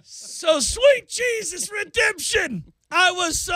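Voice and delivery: joyful relief. Real, not acted. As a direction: belt it out like salvation just arrived. Relief at news of the mailbox.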